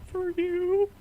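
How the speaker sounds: high falsetto mouse, mock crying; tearful cartoon mouse impression, loud and high; Mickey Mouse, near tears. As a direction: squeak it out as if about to cry. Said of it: "nearly hear him crying when he says it".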